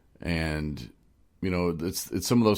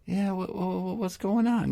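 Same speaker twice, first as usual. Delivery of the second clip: much higher, stammering mock-nervous voice; Justin impression; 12 semitones higher than usual. Host imitating his newly sober friend, high and stammering. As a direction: pitch up and stumble over the whats like an eager kid. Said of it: "You heard how he talks".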